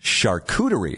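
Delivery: Charcuterie said shar-KOO-duh-ree; correct pronunciation. Shown as a demonstration. Host repeats the hard word, Charcuterie as shar-koo-duh-ree. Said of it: "that's how you correctly pronounce it".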